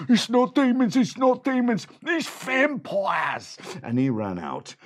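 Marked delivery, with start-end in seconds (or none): 0.1-3.6 high loud frightened cry; panicked shout. A narrator's reading. Bandit correcting the others, shrieking high and loud. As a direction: yell it in terror. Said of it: "Bojangle Bob said".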